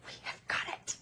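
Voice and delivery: soft, voiceless mock-solemn whisper; awed whisper. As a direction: whisper it as a hushed declaration of success. Acts out her awed reaction again.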